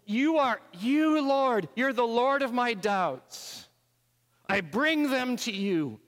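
loud, high, impassioned praying; fervent prayer. Real, not acted. Preacher praying aloud to Jesus, loud and fervent. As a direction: pour it out to God.

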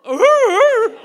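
very loud, high "ooh" of mock dismay. Hearing the paramedic's grim joke, loud high mock-dismayed ooh. Paramedic says least of her problems.

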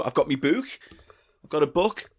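usual speaking voice

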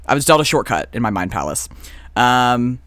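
ordinary delivery

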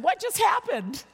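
high-pitched bewildered exclamation; amazed. Retold or imitated. Acts amazed reaction after visit.

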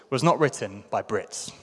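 ordinary delivery

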